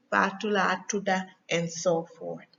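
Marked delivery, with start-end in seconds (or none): 0.1-1.3 Gambian Wolof name reply, "tuda" with final a; Gambian accent. Shown as a demonstration. Teacher giving her name as an example, Gambian accent. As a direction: end "tuda" on "ah", Gambian style. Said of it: "Senegalese accent and Gambian accent".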